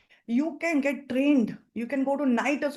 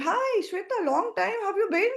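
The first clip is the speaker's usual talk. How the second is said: friend Shashi greeting; 9 semitones higher than usual. Shown as a demonstration. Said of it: "Shashi says".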